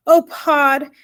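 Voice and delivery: wailing. A narrator's reading. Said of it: "wailed Homily".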